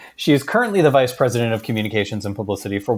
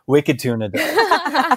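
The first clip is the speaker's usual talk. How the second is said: put-on Boston accent, higher and slow; 14 semitones higher, much slower than usual. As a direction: draw it out in a joking Boston accent. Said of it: "would not have a Boston accent".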